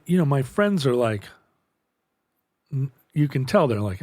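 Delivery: usual speaking voice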